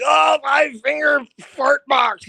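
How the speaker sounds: high, slow, sneering shout; mock the band like a hater would. Fans who dislike the band mocking its name, high slow shout. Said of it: "some people are like".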